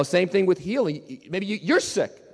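ordinary talk

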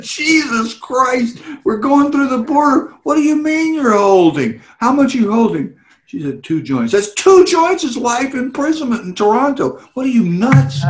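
retold panic.

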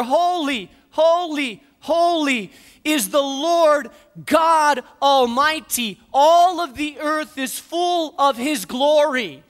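high, loud, slow declaration of holy; ringing, awestruck praise; loud angelic proclamation. Retold or imitated. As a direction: call it out like a choir of angels. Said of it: "spoke loudly to one another".

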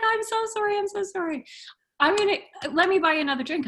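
high, gushing imitation of apology; mimicking an over-sorry guy; effusive apology. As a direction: plead high-pitched for forgiveness. Guy apologizes again in retelling.

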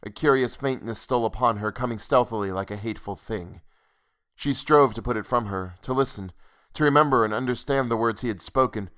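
usual speaking voice

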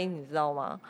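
ordinary talk

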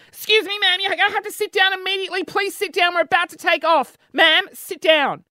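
high, rushed flight attendant order; urgent cabin crew. Retold or imitated. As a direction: tell a stubborn passenger to sit down, high and urgent. Cabin crew ordering passenger to sit.

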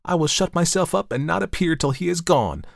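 high, urgent threat; desperate. A narrator's reading. Sister threatens to hide from the guest, desperate. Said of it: "said the almost desperate girl".

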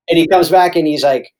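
normal talking voice

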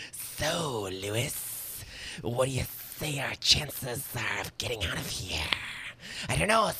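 breathy whispered alien creature. Acted. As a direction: hiss it out, high and eerie. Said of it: "So that's my alien voice".

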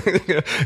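high, short laugh at his own joke; laughing at the grab-me joke; amused laugh. Real, not acted. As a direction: chuckle at the image.

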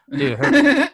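high, loud burst of laughter on the words; laughing. Real, not acted. Friend telling of the flying phone, laughing. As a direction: crack up while starting to speak.

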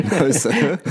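laughing, high mock-polite reply. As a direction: giggle through a high "No, sir". Jokey reply to the officer.

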